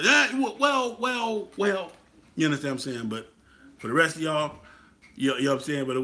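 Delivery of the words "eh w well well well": high, loud stalling "well, well"; a playful, pitched-up stammer of hesitation; mock hesitation. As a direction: hem and haw as if caught out. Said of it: "I might have to".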